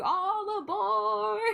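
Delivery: high, slow, stretched-out rock shout; drawn-out high "All aboard!" yell; [name] impression. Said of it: "and it just goes".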